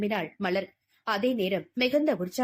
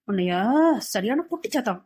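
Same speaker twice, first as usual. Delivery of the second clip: usual speaking voice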